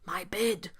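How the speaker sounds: low, soft stage whisper; whispered alarm. A narrator's reading. Papa Bear spotting his bed, whispering. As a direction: whisper it in disbelief. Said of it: "whispered Papa Bear".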